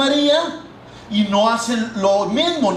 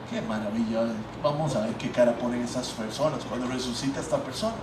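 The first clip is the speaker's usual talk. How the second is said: gloating aside; 9 semitones lower, 9 dB quieter, faster than usual. Pretend. Imagined gloating aside at funeral.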